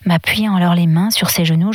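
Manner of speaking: ordinary delivery, nothing marked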